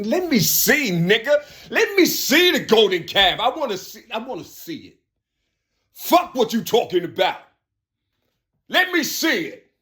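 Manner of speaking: high, loud demanding voice; mock greedy crowd. Crowd demanding the golden calf, high and pushy.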